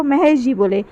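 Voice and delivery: usual speaking voice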